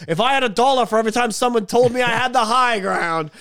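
lightly voiced Obi-Wan quip; mock Ewan line. Host retelling Ewan's joke, light mock voice. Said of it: "Ewan cuts him off and goes".

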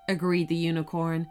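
usual speaking voice

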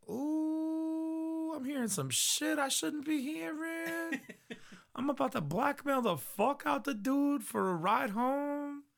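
very high, drawn-out singsong falsetto; put-on falsetto of a plotting idol; scheming eavesdropper. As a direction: coo it high and slow, relishing the gossip. Voices idol eavesdropping gleefully.

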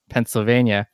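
ordinary talk

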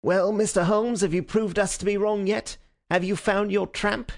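high, gloating police inspector; triumphant Lestrade. A narrator's reading. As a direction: crow it like a smug inspector who thinks he has won. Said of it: "his manner grossly triumphant".

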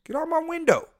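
high-pitched exasperated yell at someone outside; re-enacting his high-pitched holler; retold yell. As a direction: yell it high, as if through the window. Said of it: "And I yelled at him".

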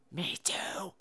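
unvoiced whispered me too; frightened man whispering to his friend at night. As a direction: whisper it back in the dark. Whispered reply.

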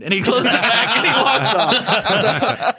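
slow, laughing narration, pitch up. Real, not acted. Telling how the chaplain gave up and left, laughing. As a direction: let the laugh stretch the words out.

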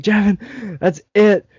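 high-pitched imitated scolding; pissed-off mom. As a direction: lay down the law like an angry mother. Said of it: "She just starts getting so pissed".

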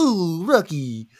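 slow cartoon moose voice; drawn-out goofy moose; Bullwinkle voice. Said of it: "things I never do impressions of".

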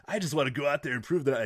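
a hint of mock wrestler promo; Matt Riddle. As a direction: lightly imitate an earnest bro. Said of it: "he was doing the bro".

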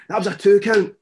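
high, loud corrected yell at the ref; correcting himself with a yell. Retold or imitated. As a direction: bellow the fix at the referee. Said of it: "I've misspoken".

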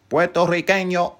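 high, loud rolled Spanish flair; theatrical Boricua pride; Spanish flourish. Acted. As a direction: say "Puerto Riqueño" with proud Spanish sounds, high and loud. Showy proud pronunciation.

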